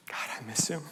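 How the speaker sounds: breathy whisper of missing him; whispered grief. Real, not acted. Pastor missing his late father, whispered softly. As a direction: let it slip out in a whisper.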